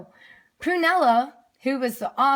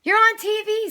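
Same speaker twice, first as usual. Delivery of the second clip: much higher, loud excited scream; 13 semitones higher, 7 dB louder than usual. A narrator's reading. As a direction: shriek the news at full volume.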